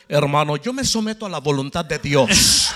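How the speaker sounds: deep, booming preacher imitation; Gigi Ávila voice. To the sister, mimicking Gigi Ávila's deep voice. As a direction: channel a solemn preacher. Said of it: "así habla Gigi Ávila".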